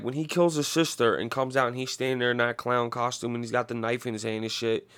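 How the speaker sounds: normal talking voice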